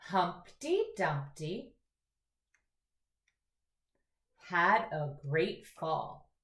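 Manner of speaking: very slow, with long pauses to echo; teacher modelling lines for kids to echo; slow echo reading. Shown as a demonstration. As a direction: read each line slowly, leaving room to repeat. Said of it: "echo reading".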